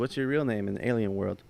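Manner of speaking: ordinary delivery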